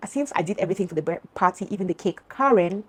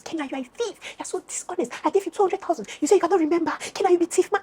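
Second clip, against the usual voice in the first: imitated screaming accusation; 10 semitones higher than usual. Friend storming in calling her thief, imitated screaming. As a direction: mimic a shrieked accusation at contained volume. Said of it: "she's screaming at the top of her voice".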